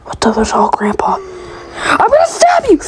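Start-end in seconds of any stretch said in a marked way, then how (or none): 1.8-2.8 sudden high shriek at the monster; panicked scream. Real, not acted. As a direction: yell it out in terror. Grandpa monster attacks.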